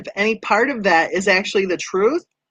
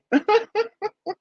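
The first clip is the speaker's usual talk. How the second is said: high giggle; 12 semitones higher, 3 dB quieter than usual. Real, not acted. Laughs after apologizing.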